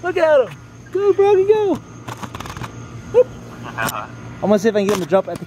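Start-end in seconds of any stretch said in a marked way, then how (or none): 0.0-3.3 high-pitched excited whoops; excited cheering. Real, not acted. Egging on a jumping frog for a friend on the phone, excited.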